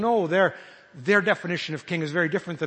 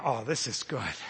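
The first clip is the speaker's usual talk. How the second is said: low, soft and content; a relaxed, pleased murmur at the table; contented sigh; 8 semitones lower, 5 dB quieter than usual. Pretend. Jesus reclining at the banquet, low and content.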